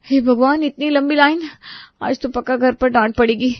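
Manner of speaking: anxious bride. Acted. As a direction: groan at the long queue. Preeti at milk shop.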